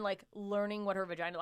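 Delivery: ordinary talk, nothing marked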